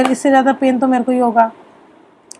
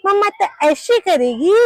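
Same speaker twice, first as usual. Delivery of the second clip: put-on higher, slow playful demo voice for a toddler; showing her daughter; 5 semitones higher, slower than usual. Shown as a demonstration. Mother showing her little daughter at the park how to play, slow higher playful voice. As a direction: draw the child in, slowly and higher. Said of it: "अपनी बेटी को दिखाने के लिए".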